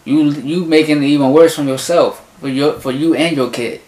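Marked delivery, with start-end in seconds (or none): none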